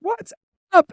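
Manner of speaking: high loud mock greeting; imitating busboy. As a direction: call it out like an excited teen.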